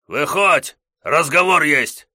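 shouting. Acted. Gos shouting at the house.

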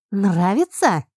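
loud, gloating taunt. A narrator's reading. Heroine taunts the man she just kicked, gloating. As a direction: gloat over him as he falls. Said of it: "спросила злорадно".

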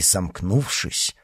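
ordinary delivery

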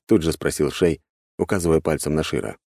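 ordinary delivery, nothing marked

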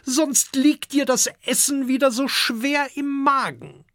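very high-pitched nagging dragon wife; female dragon scolding. A narrator's reading. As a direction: warn in a high she-dragon voice. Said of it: "sagt eine Fressdrachin". (hohe, mahnende Drachinnenstimme)

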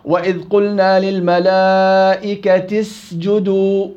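slow, chanted recitation of the verse; Quranic recitation. Shown as a demonstration. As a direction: recite it slowly in tajweed style. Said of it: "انتقلت من التاء المكسورة". (تلاوة مجودة بطيئة)

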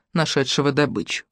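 plain reading voice

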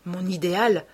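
ordinary delivery, nothing marked

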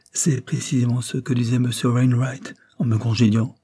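plain reading voice

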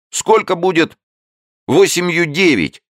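put-on high, loud child's voice squeezed out; shy boy. A narrator's reading. Embarrassed boy asking the newt a sum, high child voice. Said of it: "выдавил он наконец".

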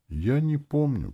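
high, slow, mournful answer; sad zombie. A narrator's reading. Dead cabman fails to recognise his master, sad. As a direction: draw it out sorrowfully. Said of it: "печально ответил мертвец".

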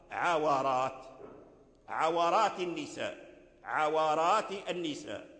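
عورات as ʕa-wa-rāt, three open beats; opened wāw. Shown as a demonstration. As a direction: sound a short a on the wāw in عورات. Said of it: "وقد قرأ الأعمش". (عورات بفتح الواو)

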